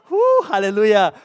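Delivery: loud, slow whoop of relief; hammed-up relief. Preacher relieved another speaker was called, loudly celebrating. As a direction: let out a big, drawn-out thankful cheer.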